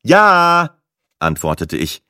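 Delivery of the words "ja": loud, high, drawn-out answer; calling back. A narrator's reading. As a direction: shout it up to the phone. Answers phone held overhead. (laut nach oben gerufen)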